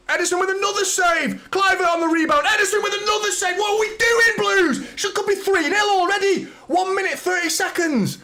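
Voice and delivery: high, loud, breathless play-by-play shouting; panicked fan calling a double save live; frantic shouting. Real, not acted. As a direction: yell every save as it happens. Goalmouth scramble, double save.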